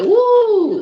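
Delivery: high, loud yelp of alarm; a big shocked woo at the request; startled woo. Retold or imitated. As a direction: yelp it out like the news just hit. Reacting again to God's request.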